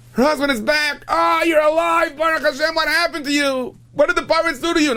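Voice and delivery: very high, loud cry of relief; frantic excited wife greeting her husband; overjoyed alarm. Acted. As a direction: exclaim as if he's back from the dead. Acted wife greeting returned husband.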